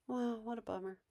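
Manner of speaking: soft, unruffled commiseration; calm sympathy. Shown as a demonstration. Parent giving a brain dead response, calm and soft. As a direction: sympathize gently without reacting. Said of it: "'cause you're being calm".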